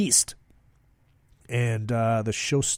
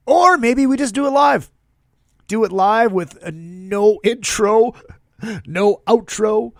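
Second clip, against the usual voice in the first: mock rant; 6 semitones higher, 6 dB louder than usual.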